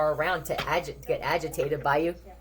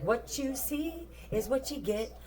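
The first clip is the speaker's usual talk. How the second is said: higher, slow, soft mock voice of a blunt person; blunt honest person; 7 semitones higher, 6 dB quieter, slower than usual. The honest one answering fake family, slow high mocking voice. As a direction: drawl the motto slowly, up high. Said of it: "somebody on the other side is like".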